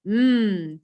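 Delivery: drawn-out hammed-up yummy hum; mock yum. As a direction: savour it like a cereal ad. Advert tasting sound.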